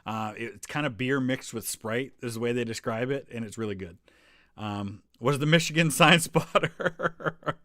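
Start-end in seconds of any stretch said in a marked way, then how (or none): 5.2-7.6 slow words broken by a real laugh. Real, not acted. Vlogger amused by a viewer's Michigan joke, laughing while reading. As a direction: chuckle through it, amused.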